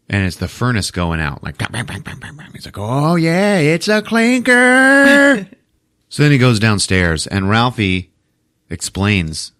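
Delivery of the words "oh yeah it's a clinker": high, drawn-out call rising at the end; mock exclaiming dad. Dad hearing the furnace bang, exaggerated exclaiming. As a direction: crow it out high, stretching the last word.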